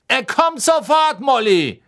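loud, high, drawn-out call; shouting back. Acted. Husband answering his wife from afar, shouting. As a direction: call back across the platform.

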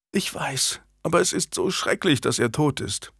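soft, high and tearful. A narrator's reading. Anne grieving her dead father to Julian, tearful. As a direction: speak through fresh tears. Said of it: "brach in Tränen aus".

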